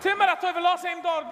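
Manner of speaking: loud call across the room. Real, not acted. Calling out loudly to the other man. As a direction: call it out so they hear.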